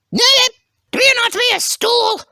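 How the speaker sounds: high-pitched eager contestant voice; high cartoon character. Contestant claiming the role of Tums, high character voice. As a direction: pipe up in a squeaky-high character voice.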